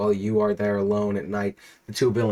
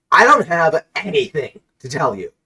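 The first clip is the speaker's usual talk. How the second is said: higher, louder, irritated snap; irritated villain; 6 semitones higher, 8 dB louder than usual. Acted. Pringles guy dismissing the hero, irritated. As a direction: brush him off, annoyed. Continuing denial.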